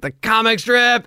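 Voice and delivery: high, drawn-out delighted echo, imitated; excited recognition. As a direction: repeat it back in thrilled surprise. Imitates star's excited reaction.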